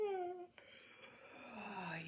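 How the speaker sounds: long sigh falling from high; yawning sigh. Shown as a demonstration. Teacher showing the breath can be a yawn, long falling sigh. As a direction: let a yawn slide down into a sigh. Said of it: "yawn, whatever it is".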